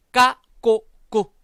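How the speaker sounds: 까, 꼬, 꾸 with tight, unaspirated k; tense k. Shown as a demonstration. Teacher modelling C before a, o, u, tense k. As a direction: hit 까, 꼬, 꾸 hard, one by one. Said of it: "쌍기역 발음이 날 거예요".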